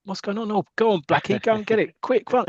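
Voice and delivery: high-pitched mock alarm from the stands; crowd reacting. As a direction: act out excited fans urging a player on. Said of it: "the crowd can see it and go".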